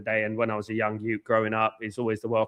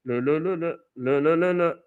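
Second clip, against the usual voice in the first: voice practice syllables; 3 semitones higher than usual. Shown as a demonstration. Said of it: "practice it, lower it".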